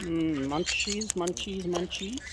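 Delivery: soft, slow cooing over food; soft coaxing. Real, not acted. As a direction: murmur it slowly to tempt the bird.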